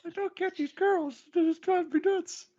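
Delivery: hammed-up exasperated girl; mock girly whine. Voicing the girl's frustration.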